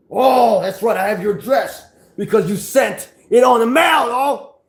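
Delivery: furious shouted threat. Real, not acted. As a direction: yell the threat, worked up. Threatening rival with his address.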